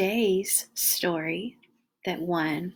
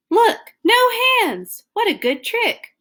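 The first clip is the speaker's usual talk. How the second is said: excited; 11 dB louder than usual. Acted. Acted delight at monkey trick.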